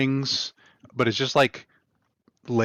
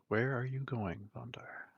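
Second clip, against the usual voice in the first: breathy whispered question; a whispered call after a departing friend; 12 dB quieter than usual. Acted. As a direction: hush it like a secret. GM voices a whispered NPC question.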